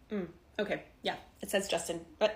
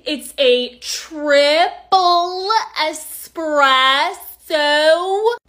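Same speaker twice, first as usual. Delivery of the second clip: very slow, loud, stretched syllables; abnormally over-enunciated; 9 dB louder, much slower than usual. Acted. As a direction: spell it out as if to an idiot. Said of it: "you can talk to me normally".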